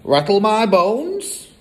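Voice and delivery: high, slow spooky parrot imitation. A narrator's reading. Parrot shows off its catchphrase to the children, spooky. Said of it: "imitation of a spooky voice".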